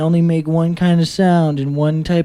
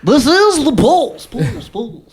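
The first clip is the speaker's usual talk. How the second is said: segment announcer; 9 semitones higher, 4 dB louder, slower than usual. Acted. Jokingly announces the new segment.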